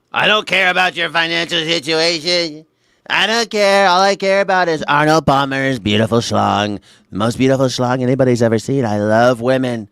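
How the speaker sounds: lightly done Trump voice, brash; boasting like Trump; Trump impression.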